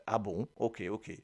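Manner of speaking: ordinary delivery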